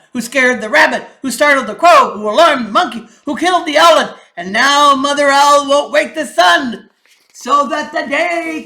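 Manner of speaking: loud, ringing king's proclamation; lion king. Acted. King addresses the council, loud and commanding. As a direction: announce the chain of blame.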